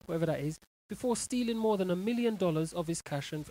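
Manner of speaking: ordinary talk, nothing marked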